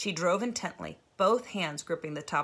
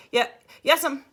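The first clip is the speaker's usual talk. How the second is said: nervous stammer; 9 semitones higher, 10 dB louder than usual. Acted. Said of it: "scared to say more for fear she'd hear the shake".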